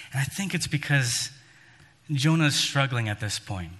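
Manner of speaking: ordinary talk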